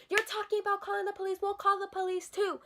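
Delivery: high, quick mocking retort; mimicked salon staff. Teller imitating staff threatening police, mocking mimicry. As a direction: throw the threat back in a high voice.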